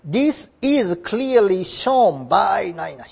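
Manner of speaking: slow, careful English model sentence; slow model reading. Shown as a demonstration. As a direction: read it out slowly like a teacher. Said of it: "このことは何々から明らかである". (ゆっくり丁寧な模範読み)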